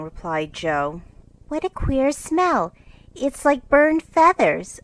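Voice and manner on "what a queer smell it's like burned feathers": high, slow, snooty remark; superior air. Acted. Amy looking down on the mess, superior. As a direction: sniff at it like a little lady. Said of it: "observed Amy".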